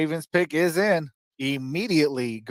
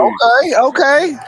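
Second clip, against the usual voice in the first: excited; 8 semitones higher, 7 dB louder, slower than usual. Real, not acted. Reacting to the Eagles pick.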